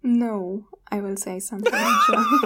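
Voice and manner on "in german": high laughing shout. Real, not acted. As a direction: burst out laughing as you say it. Laughing announcement.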